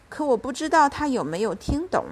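usual speaking voice

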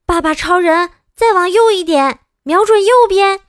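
high, loud directing yells; loud shout. Acted. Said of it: "我大叫道".

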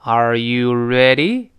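"Are you ready" drawn out, full "you", rising on ready; slow teaching demo with a clear "you"; slow full reading. Shown as a demonstration. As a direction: spell out each word slowly for learners. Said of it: "读快之后，那个you又自然会发生元音的弱化".

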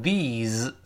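"these" slowly, long ee and a buzzing z at the end. Shown as a demonstration. Teacher contrasting "these" with "this" for pupils. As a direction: stretch the ee in "these". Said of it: "这些发一，长音".

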